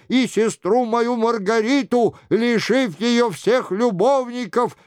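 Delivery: high-pitched mock-royal confession; sing-song show of repentance; imitating the king. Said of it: "продолжал за Генриха Шико".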